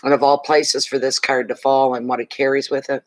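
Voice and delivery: usual speaking voice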